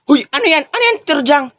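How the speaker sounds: stern teacher scold. Acted. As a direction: order the stolen paper returned. (galit na utos ng guro)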